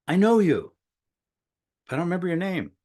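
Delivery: high-pitched, acted-out recognition; lightly dramatised surprise, pitched well up; surprised recognition. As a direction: replay suddenly placing a familiar stranger. Acts out recognising an old acquaintance.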